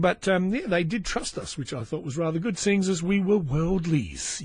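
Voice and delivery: ordinary delivery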